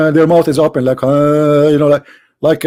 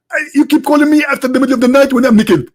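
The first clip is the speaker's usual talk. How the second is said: much higher, indignant preacher voice; Zakir Naik impression; 8 semitones higher than usual. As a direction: play the annoyed preacher being called. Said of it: "Doctor Zakir Naik".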